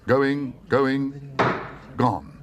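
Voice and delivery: a hint of auctioneer's call; lightly voiced auctioneer. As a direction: close the sale briskly. Auctioneer closing the sale.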